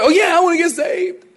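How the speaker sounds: high-pitched, instantly keen; eager convert. Acted. New convert answering a stranger's gospel, eagerly.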